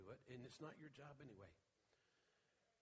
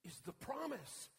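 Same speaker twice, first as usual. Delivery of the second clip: preaching at full force; 11 semitones higher, 11 dB louder than usual. Acted. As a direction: announce the long-awaited promise in awe.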